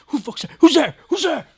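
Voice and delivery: high, loud panicked shout of who's there; retold startled yell. Startled roommate yelling at friends entering his house, loud high shout. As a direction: yell at the noise like you just woke up scared.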